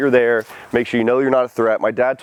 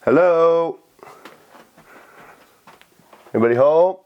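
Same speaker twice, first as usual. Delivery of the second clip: calling out; 5 semitones higher than usual. Real, not acted. Announcing self.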